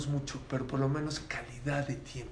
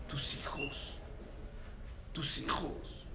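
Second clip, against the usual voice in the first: whispered, much softer; 10 dB quieter, slower than usual. Real, not acted. Preacher stressing children to the congregation, whispered. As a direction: lean in and breathe it. Sermon climax, key point.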